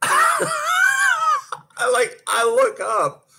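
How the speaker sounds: high, laughing, slow, breaking into a cry-laugh; laughing in disbelief. Real, not acted. Skater hearing her coach read the time, high laughing cry. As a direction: laugh it out like you can't believe it.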